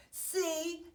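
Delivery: high, bright "See"; exclaiming. A narrator's reading. Marilyn proving herself right, exclaiming. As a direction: crow a little. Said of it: "exclaimed Marilyn".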